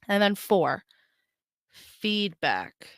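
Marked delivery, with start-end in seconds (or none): none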